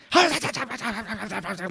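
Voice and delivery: high, rapid gibberish; mock Home Alone villain noise; cartoon crook babble. Joe Pesci style babbling.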